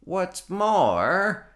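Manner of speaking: high, loud snap; snapped. A narrator's reading. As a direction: bite the words off crossly. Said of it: "snapped the Lorax".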